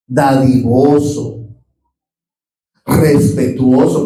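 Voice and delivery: each virtue drawn out slowly; slow emphatic list. Acted. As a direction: savour every word of the list. Listing God's virtues slowly.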